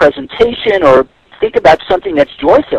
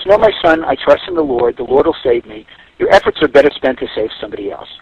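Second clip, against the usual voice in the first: low, solemn priest voice, lightly acted; a calm cleric turning down help; priest refusing rescue; 12 semitones lower, 3 dB quieter than usual. Joke priest tells the rowboat man no, low and solemn.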